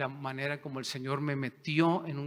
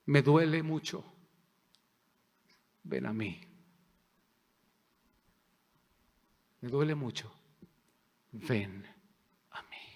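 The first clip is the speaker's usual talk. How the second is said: a touch of tearful pleading, fading to almost nothing; mild retold weeping prayer; 2 semitones lower than usual.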